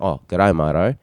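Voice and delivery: low-pitched Australian contestant; Australian accent. Acted. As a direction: introduce yourself in a broad-vowelled Australian accent. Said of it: "like an Australian".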